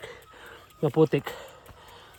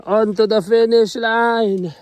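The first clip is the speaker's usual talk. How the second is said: slow rallying call; 5 semitones higher, 6 dB louder, much slower than usual. Real, not acted. Final push to summit.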